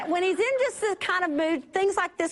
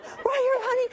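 high call; 8 semitones higher than usual. Retold or imitated. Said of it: "I just kept going".

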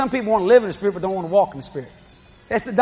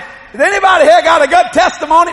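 much higher, louder preaching call; preacher's call; 8 semitones higher, 8 dB louder than usual. Retold or imitated. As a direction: belt it to the whole church. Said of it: "I said".

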